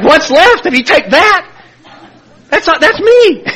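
high, loud mock disbelief; exasperated protest. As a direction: drag out a high-pitched protest. Retold protest about losing defects.

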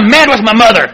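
retold angry yell; angry admission. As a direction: blurt it out high and loud. Retold outburst in therapy group.

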